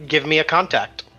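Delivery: ordinary delivery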